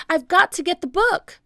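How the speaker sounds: high, loud, quick reply; hurried holler over distance; calling out. A narrator's reading. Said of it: "Jack called".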